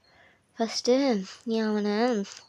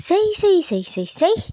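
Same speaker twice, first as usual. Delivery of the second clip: loud, high repeated tut of exasperation, falling away; fed-up repeated exclamation; frustrated outburst; 9 semitones higher, 5 dB louder than usual. Acted. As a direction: burst out in acted exasperation. Frustrated outburst after quoting him.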